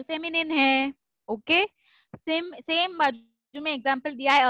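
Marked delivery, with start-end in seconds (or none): none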